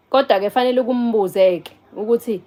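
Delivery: ordinary talk, nothing marked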